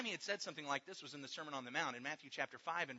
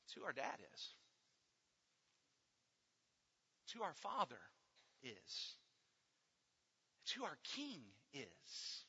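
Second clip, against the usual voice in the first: hushed; 5 semitones lower, 13 dB quieter than usual. Real, not acted. Tender point after big build.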